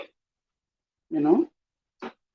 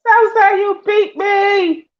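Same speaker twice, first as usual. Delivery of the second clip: pitched way up, crying out in defeat; high excited yelp; 3 dB louder, slower than usual. Real, not acted. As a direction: yelp it high like you just lost. Repeating the game loss cry.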